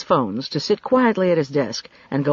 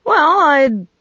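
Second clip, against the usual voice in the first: flustered start; 10 semitones higher, 7 dB louder than usual. Acted. Bishop cut off, flustered.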